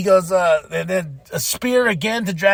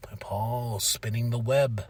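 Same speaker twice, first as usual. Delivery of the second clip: low, slow, very soft; hushed, drawn-out intrigue; sly hush; 6 semitones lower, 9 dB quieter, slower than usual. Retold or imitated. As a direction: creep in quietly like a schemer. Narrating a schemer's arrival.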